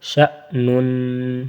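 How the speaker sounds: slow, drawn-out شأنن; slow tajweed drill; recitation drill. Shown as a demonstration. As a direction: stretch each syllable. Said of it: "spelling drill".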